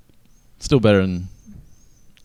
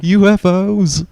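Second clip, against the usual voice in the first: mocking; 13 semitones higher, 7 dB louder, much slower than usual. Mocking the UFO ending.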